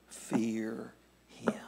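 whispered close. Acted. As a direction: whisper the last words. Reading scripture softly.

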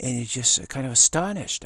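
usual speaking voice

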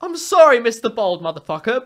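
ordinary talk, nothing marked

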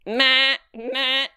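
high, held bleating; child's goat bleat. A narrator's reading. Grace talks to the goats, bleating. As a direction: bleat back like a little goat.

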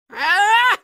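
short, alarmed "ah"; startled yell. Acted. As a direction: cry out at a sudden threat. Startled by mummies.

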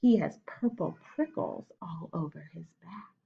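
whispered monster detail; spooky whisper. Acted. Mouse whispering to the snake, spooky hushed whisper.

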